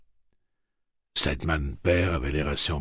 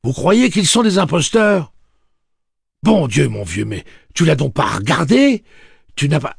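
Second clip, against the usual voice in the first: much higher, much louder furious protest; indignant shout; 12 semitones higher, 14 dB louder than usual. A narrator's reading. Tian berating Overholser before his wife stops him, angry shout. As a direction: hammer him with outrage until cut off. Tian's indignant outburst continues.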